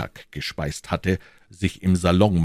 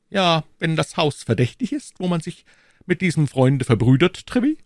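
higher royal voice, firm; the king's voice, raised pitch; 11 semitones higher, 4 dB louder than usual. A narrator's reading. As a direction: speak as the king, assured. King voiced.

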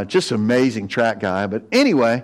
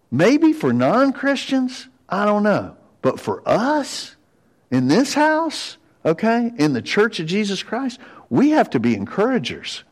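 emphatic preaching; 9 semitones higher than usual. Real, not acted. Pastor urging the church to encourage, emphatic and high. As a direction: lift it up and stress each phrase.

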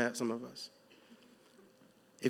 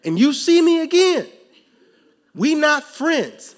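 preaching peak; 10 semitones higher, 10 dB louder than usual. Acted.

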